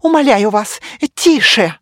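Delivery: high, loud, desperate begging; terrified pleading hostage. A narrator's reading. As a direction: beg them to hush, terrified. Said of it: "дрожащим голосом прошептала".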